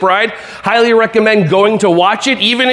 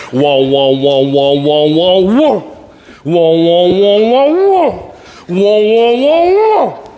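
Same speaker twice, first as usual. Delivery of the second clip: dodging whoa sounds; much slower than usual. Pretend. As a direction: yelp as if ducking a laser. Dodging pretend laser game.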